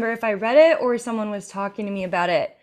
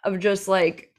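ordinary delivery

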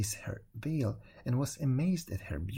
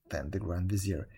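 ordinary delivery, nothing marked

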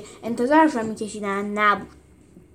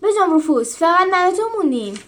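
higher, louder shout; 8 semitones higher, 6 dB louder than usual. Acted. Julius shouting to Rufus that only they remain. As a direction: call out alarmed. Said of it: "فریاد زد".

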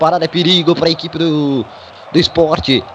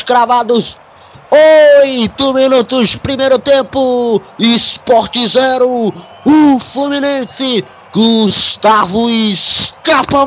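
screamed goal call, high and loud; elongated, drawn-out goal celebration shout; explosive goal commentary; 8 semitones higher, 4 dB louder, slower than usual. Real, not acted. Goal scored, commentator erupts.

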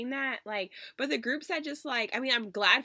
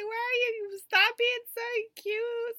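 overwhelmed fan; 8 semitones higher than usual. Retold or imitated. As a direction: squeak it like a fan undone by cuteness. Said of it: "And I'm just like".

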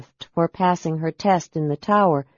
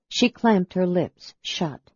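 ordinary delivery

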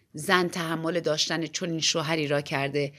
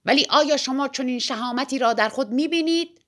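raised, higher-pitched challenging question; narrated cry, moderately raised; shouted challenge; 6 semitones higher, 4 dB louder than usual. A narrator's reading. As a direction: call out a dare to her. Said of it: "فریاد زد".

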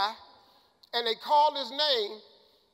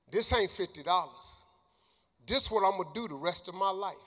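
low-pitched, emotional insistence; retold tearful speech; 6 semitones lower than usual. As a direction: reenact tearful conviction in a lowered voice. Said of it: "I'm crying".

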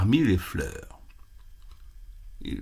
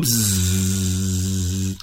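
drawn out low buzzing; slow low bee buzz; 6 semitones lower than usual. Acted. Bee buzzing into the goat's ear, slow drone. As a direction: drag the bzzz like a droning bee.

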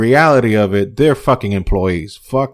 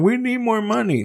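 high, softer imitation of a plea; mock whining; 11 semitones higher, 5 dB quieter than usual. Host mocks the company crying for cash, mock whine. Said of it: "they're still crying".

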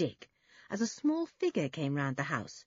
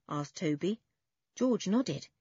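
ordinary delivery

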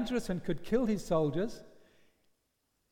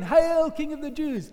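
higher, brisk mock salute; mocking hail again; 7 semitones higher, 5 dB louder than usual. Soldiers bowing a second time, mocking hail. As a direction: jeer the title with a fake bow. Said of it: "they bow down again".

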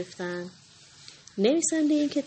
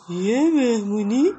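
slow, louder bear calling out; surprised exclaim; 3 semitones higher, 6 dB louder, slower than usual. Acted. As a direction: sound amazed at the news. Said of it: "خرس فریاد زد". (تعجب بلند و کشیده)

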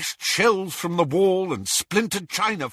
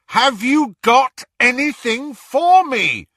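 raised, slow and insistent; louder; 9 semitones higher, 7 dB louder than usual. A narrator's reading. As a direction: ask it again, louder. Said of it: "much louder".

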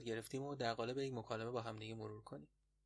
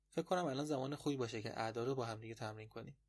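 normal talking voice

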